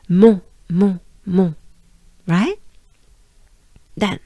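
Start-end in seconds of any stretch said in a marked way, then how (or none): 0.1-1.5 mon repeated loudly with strong nasal vowel; nasal French mon. Shown as a demonstration.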